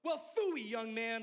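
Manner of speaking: high, loud preacher's rebuke; mock-scolding shout in an old preacher's voice; Pastor Charlie yelling. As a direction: yell it high, scolding a young man. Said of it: "he just yells at me in front of everyone".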